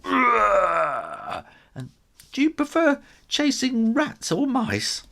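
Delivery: high, quick offer; eager kitten. A narrator's reading. Kitten offering Tiddles an apple, high and fast. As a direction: chirp it out eagerly.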